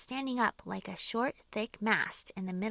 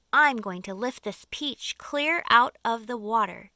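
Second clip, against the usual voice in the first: firm; 3 semitones higher, 11 dB louder than usual. A narrator's reading. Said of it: "James announced firmly".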